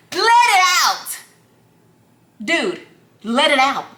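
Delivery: high, drawn-out impatient yell; exasperated outburst. Retold or imitated. Girlfriend cutting off boyfriend's long hedging, exasperated yell. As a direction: burst in on the endless preamble, stretching it.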